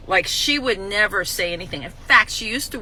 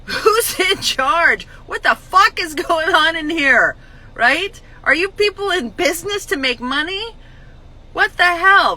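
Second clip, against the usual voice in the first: angry rant; 7 semitones higher, 6 dB louder than usual. Retold or imitated. The old me yelling at deli staff, loud and furious. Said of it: "the old me would have lost my mind and said".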